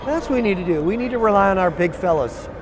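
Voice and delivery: ordinary delivery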